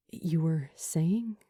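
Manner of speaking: hushed, curious; whispered. A narrator's reading. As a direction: gently coax her on. Luke quietly pressing Julie.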